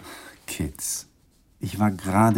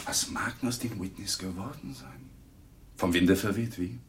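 mock mystery; 2 semitones lower, 9 dB quieter than usual. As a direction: trail off in feigned wonder. Husband mocking wife's lost friends. (leise, ironisch raunend)

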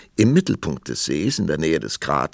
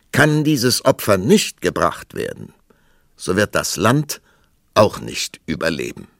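loud, emphatic reading of a warning; grave proclamation; 3 semitones higher, 6 dB louder than usual. Acted. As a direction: proclaim it like a dire decree. Reads the tablet's warning aloud.

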